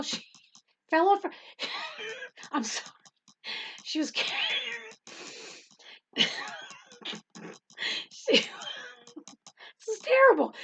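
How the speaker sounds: helpless real laughter breaking the words; cracking up. Real, not acted. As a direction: try to talk while cracking up. Cracking up retelling child's fall.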